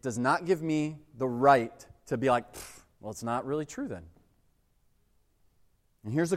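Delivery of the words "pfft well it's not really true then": dismissive scoff. Retold or imitated. Dismissive voice of a doubter.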